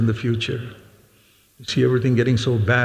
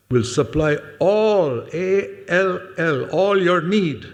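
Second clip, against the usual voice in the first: "all" drawn out slowly and spelled letter by letter, "A L L"; stress on all; 6 semitones higher, slower than usual. Real, not acted. Preacher quoting his favorite verse, heavy slow stress on "all". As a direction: stretch "all" high and long, then spell it.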